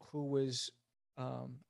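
ordinary delivery

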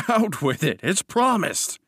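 high, merry burst; amused, pitch leaping up; laughing assent. A narrator's reading. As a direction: toss it out gaily on a laugh. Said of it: "he laughed".

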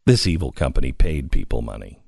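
low, fast mock outrage; deadpan scorn at the evil company; sarcastic.

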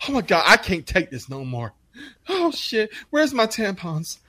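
high, whiny despair; mock an oversensitive kid falling apart; hammed-up helpless wailing; mock meltdown. Mocks sensitive co-workers.

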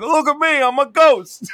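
high, loud goofy ghost voice. Retold or imitated. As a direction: play a silly ghost, big and dopey. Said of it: "and he's like".

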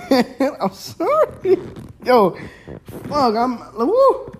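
loud, high, giggling goodbye; laughing sign-off. Real, not acted. Host laughing at his own fumbled outro, loud. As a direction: crack up while trying to sign off.

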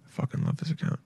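breathy whispered enthusiasm. Real, not acted. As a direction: whisper it like a secret. Whispered aside.

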